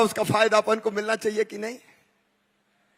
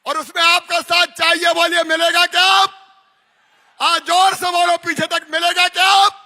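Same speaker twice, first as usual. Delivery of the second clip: shouting, higher, to the back rows; booming political rally speech; 4 semitones higher, 6 dB louder than usual. Real, not acted. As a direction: rouse the crowd to answer. Rally crowd call, shouting.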